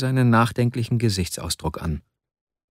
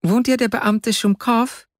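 put-on high-pitched, slow child voice; little child calling out a question, high and drawn out; child's voice; 12 semitones higher, slower than usual. A narrator's reading. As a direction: ask like a small child, pitched way up. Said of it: "ertönte eine Kinderstimme auf der Treppe".

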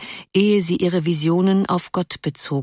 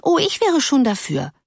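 put-on mediating girl; 8 semitones higher than usual. A narrator's reading. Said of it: "suchte eine dritte zu vermitteln".

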